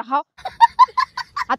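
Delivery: very high, slow, sugary hā hā; sweet drawn-out yes. Shown as a demonstration. Daughter showing mother how to answer sweetly, high and slow. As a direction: stretch it out sweet and high to show the proper way. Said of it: "तो ऐसा बोलना".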